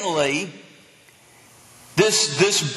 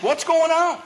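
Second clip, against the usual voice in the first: blind man asking; 9 semitones higher than usual. Acted. Blind man asks the passing crowd, high-pitched questioning. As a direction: call out a puzzled question, pitched well up. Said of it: "which was ask".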